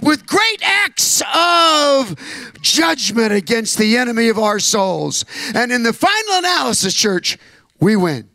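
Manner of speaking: full-force preaching. Acted. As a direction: preach it to the rafters, shouting victory.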